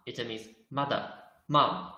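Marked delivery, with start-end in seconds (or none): none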